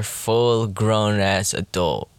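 each word drawn out slowly; slow emphatic. Real, not acted. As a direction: spell it out, slow and firm. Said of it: "I am a mature adult".

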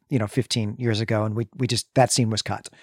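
normal talking voice

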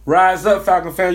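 loud call to the fans; loud sign-off shout to fellow fans; rallying cry. Real, not acted. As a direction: rouse the crowd like a stadium chant. Fan rallying the Falcons crowd.

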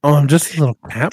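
put-on loud, purring cat-boy act. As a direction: play a needy little cat. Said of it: "a cat girl?".

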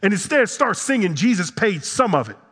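high-pitched, driving sermon delivery; impassioned preaching. Acted. Preacher challenging the congregation's theology, impassioned. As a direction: preach it hard, punching key words.

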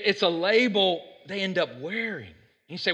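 ordinary talk

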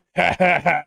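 big hearty guffaw, loud and high; mock Russian guffaw to finish the joke; guffawing laugh. Shown as a demonstration. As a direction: cap the joke with a booming belly laugh. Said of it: "you have to add it with a guffawing laugh there".

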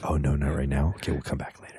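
soft, half-whispered backing off; hushed retreat. Pretend. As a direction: slink away quietly. Instagram retreating.

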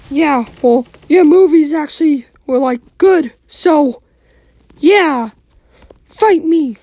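heated family shouting. Acted. Mickey challenges Kermit.

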